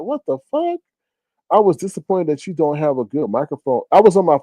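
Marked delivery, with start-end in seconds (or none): 0.0-0.8 shocked disbelief. Retold or imitated. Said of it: "I'm like".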